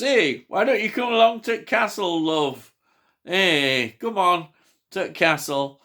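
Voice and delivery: loud, high mock voice, coaxing a guest along; inviting Baroness. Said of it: "who, of course, says".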